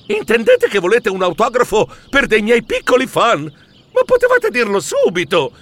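high, sing-song excitement; delighted. Acted. Mouse thinking they want an autograph, high sing-song delight. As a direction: preen at the idea of fans.

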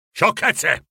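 put-on a grumpy old miser dismissing the word "Sciocchezze!" in a different timbre; irritated grumble. Scrooge brushing off his nephew's greeting, irritated grumble. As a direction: scoff it away like a sour old man. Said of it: "bofonchiò lui, seccato".

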